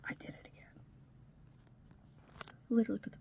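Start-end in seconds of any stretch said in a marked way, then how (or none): 0.0-0.8 whispered under the breath, real annoyance at herself; quiet self-directed aside in a whisper; muttered aside. Real, not acted. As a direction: mutter it to yourself, barely audible. Aside after hitting phone button.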